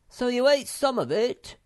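high, loud accusing cry; screamed. A narrator's reading. Moomin Troll catches Sniff out, screaming. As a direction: shout the question high, accusing. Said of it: "screamed Moomin Troll".

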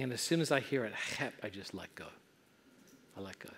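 very low, very soft storytelling; softly fading, reverent hush; hushed climax. Real, not acted. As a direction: drop to a near murmur for the big moment.

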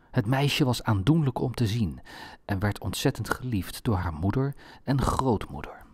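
plain reading voice

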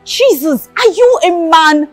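loud, heated dressing-down; angry scolding. Acted. Scolding a woman for proposing to a man, angry.

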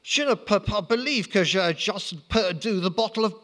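put-on high squeaky French-accented voice; defeated Napoleon squeaking in Franglais. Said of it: "Napoleon squeaked".

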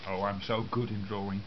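normal talking voice

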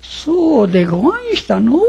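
put-on old woman realizing. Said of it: "言い出した".

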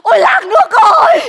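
high, loud jubilant proclamation with laughs; shouting to the village. Acted. Wife loudly tells the neighbours they're rich. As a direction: call out to the whole village.

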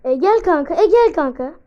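loud call to a teammate; loud come here buddy. Real, not acted. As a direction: shout across the map to your mate. Calling teammate over loudly.

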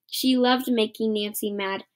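ordinary delivery, nothing marked

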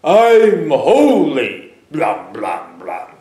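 mock holy lump, booming and drawn out; oafish lump. Preacher mocking the idle sanctified believer, oafish lump voice. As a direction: drag it out like a useless lump. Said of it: "sit around like a lump and going".

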